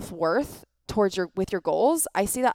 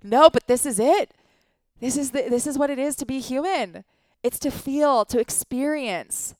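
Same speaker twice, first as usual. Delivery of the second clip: impassioned retort; 4 semitones higher, 3 dB louder than usual. Retold or imitated. Retold defence of her crying.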